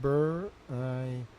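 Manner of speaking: "Beraikovich" softly and slowly, bur... eye... koh-vich; sounding out. Shown as a demonstration. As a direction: muse over "Beraikovich" syllable by syllable, softly. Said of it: "It's Beraikovich".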